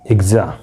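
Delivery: एग्जा said with a light g sound followed by a light z sound. Shown as a demonstration. Teacher showing students the g and z sounds in एग्जा. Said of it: "थोड़ा सा जी की आवाज़ और थोड़ा जेड की आवाज़".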